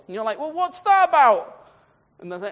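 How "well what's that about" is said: high, loud mock bafflement; baffled questioning. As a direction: act out a puzzled listener's protest. Acts out a puzzled reader.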